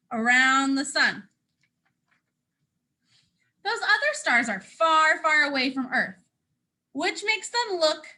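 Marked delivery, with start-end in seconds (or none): 3.6-8.0 lively storybook read as the boastful sun; reading to children. Acted. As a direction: proudly tell it as the sun to little listeners. Sun's story continued.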